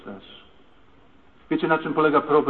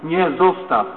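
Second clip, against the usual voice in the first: raised, higher retold cry; desperate protest; 4 semitones higher, 6 dB louder, slower than usual. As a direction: protest loudly while clinging on. Kicked wife cries out, retold. (podniesiony, rozpaczliwy sprzeciw)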